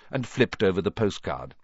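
usual speaking voice

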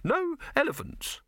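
high-pitched, insistent little objection; anxious insistence. A narrator's reading. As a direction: lay down the condition in a high voice. Reluctant partner sets firm condition.

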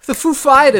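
light Walken-style delivery; hint of Christopher Walken. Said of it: "That's my Christopher Walken imperson".

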